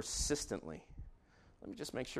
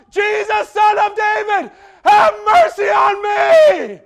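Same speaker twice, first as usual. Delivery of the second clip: high, loud slow shout; crying out; 25 dB louder, slower than usual. Acted. Blind Bartimaeus crying out louder to Jesus. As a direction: cry out all the more. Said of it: "He cries out all the more".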